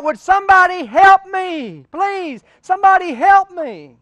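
loud, high desperate yell; hammed-up call for help from a cliff; man dangling from a limb yells for rescue; crying out for help. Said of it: "he cries out".

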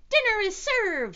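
high, loud dinner call; gleeful announcement. A narrator's reading. Rufus spots plenty of food, excited announcement.

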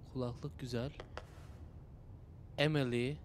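hushed under the breath; whispering. Real, not acted. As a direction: mutter softly while sneaking. Sneaking, talking under breath.

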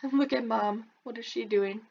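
ordinary delivery

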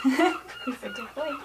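very high, slow, gushing squeal at the dog; high-pitched doting praise for the puppy. Real, not acted.

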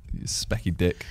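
low, breathy muttered jibe; whispered insult. Real, not acted. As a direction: slip the insult in under your breath. Teasing insult, laughing.

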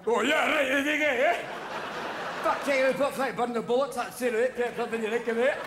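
high, garbled Scottish brogue; drunken Scotsman babbling in thick accent; incomprehensible Scottish. Acted. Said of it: "you can't fucking understand them".

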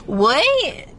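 normal talking voice

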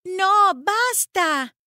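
high, loud protest; girl's shout. A narrator's reading. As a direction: cry out to make them stop. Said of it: "gritó Annie".

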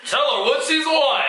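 slow, drawn-out host announcement; game show host. Pretend.

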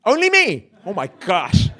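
high, loud, laughing Only me; laughing confession. Real, not acted. Preacher confessing to congregation, laughing loudly. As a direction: owns up with a shriek of laughter. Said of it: "Okay, I'm confessing today".

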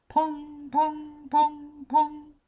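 very high, ringing electronic ping; ping it out like a sonar tone; bright repeated alarm ping; high pinging pong. A narrator's reading. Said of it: "annoyed him with that sound".

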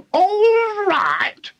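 very high, outraged screech; yelling. A narrator's reading. Grandma outraged at George's reassurance, yelling. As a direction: shriek it back as a question. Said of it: "she yelled".

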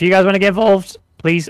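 voice crack. Real, not acted. Streamer urging viewers to get involved, voice cracking. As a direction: voice cracking mid-plea. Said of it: "did you hear that voice crack".

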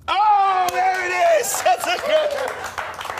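high loud triumph; gleeful crowing; triumphant shout. Real, not acted. Triumph at answer.

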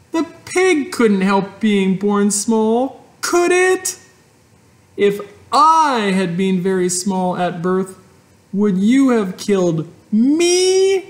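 put-on a desperate little girl; Fern pleading. A narrator's reading. Fern pleads with her father, high.

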